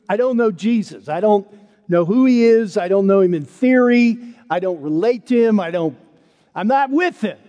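falling over himself to prove it; frantic denial. Acted. Peter to the servant girl, denying Jesus in a loud panic.

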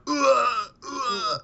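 high straining grunts; power up scream. Retold or imitated. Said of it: "So he's just gonna be like".

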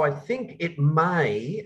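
"may" drawn out slowly for emphasis; slow down and weight the word "may"; stress on MAY. Real, not acted. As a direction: lean on "may" as a careful hedge. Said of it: "I stress the word may".

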